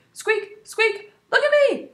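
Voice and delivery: very high, loud hamster squeaks; desperate squeaky plea for attention; loud squeaking. Acted. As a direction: squeak for attention over the crowd. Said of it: "I began squeaking loudly".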